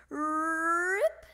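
long rolled, rising rip; drawn-out tearing noise; ripping sound effect. A narrator's reading. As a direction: stretch the word like tearing cardboard.

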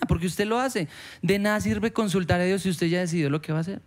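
ordinary talk, nothing marked

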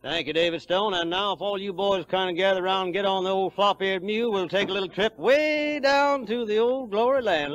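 ordinary delivery

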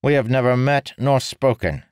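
loud, high indignant retort; angry. A narrator's reading. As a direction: fling it back, offended. Ilhadal's angry retort continues.

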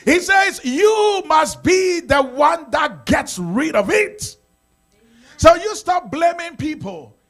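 loud, high preaching, the list of sins shouted; full-force preaching. Acted. Pastor shouting the list of sins to the congregation, each word hurled. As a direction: shout each sin out from the pulpit.

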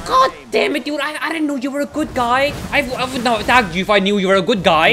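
high, loud, rushed complaint; worked-up pleading exasperation; exasperated protest. Real, not acted. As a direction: argue back in frustration. Exasperated after attacking friendly character.

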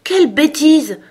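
high, forceful exclamation; loud and resolute protest; loud, decided. A narrator's reading. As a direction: cut in firmly at full voice. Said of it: "d'une voix forte et décidée".

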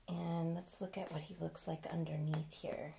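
hushed secretive whisper. Real, not acted. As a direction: lean in and whisper it. Peeks under the romper.

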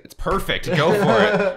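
high, loud, fake-eager encouragement; mock cheerleading. Friends egging him on to fail, in mock enthusiasm. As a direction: hype the bad idea up with mock enthusiasm. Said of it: "all of you are just like".

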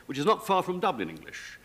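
ordinary talk, nothing marked

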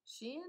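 "Shein" as a soft, rising question; unsure pronunciation. Shown as a demonstration. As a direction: try out "Shein" quietly, unsure of it. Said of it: "not quite sure how to pronounce it".

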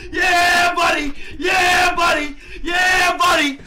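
high, repeated victory yell; real jackpot elation; triumphant shouting. Real, not acted. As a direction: holler it to celebrate a big win. Celebrating a huge gambling win.

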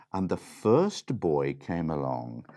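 usual speaking voice

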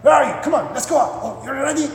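gee the dog up in a high excited voice; acted giddy owner calling a pet; over-excited dog owner. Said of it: "this is what you do every time you wanna go out".

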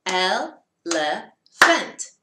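Elephant said very slowly as el-e-phant; syllables split. Shown as a demonstration. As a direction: clap out Elephant in three beats. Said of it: "how many syllables does elephant have?".

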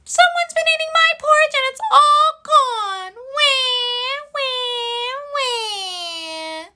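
put-on very high, loud, whiny baby wail; crying Baby Bear. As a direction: wail like a tiny bear who lost his breakfast. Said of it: "Baby Bear looked at his and said".

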